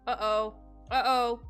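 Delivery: loud, sudden alarm; alarmed call. Real, not acted. Streamer realizing somebody got left behind, loud alarm. Reacting to trouble in game.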